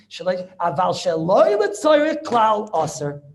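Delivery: loud, stretched stress on "sheloi letzarech klal"; emphatic quoting. Shown as a demonstration. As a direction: punch "sheloi letzarech klal" slowly and loudly. Emphatic reading of the quoted clause.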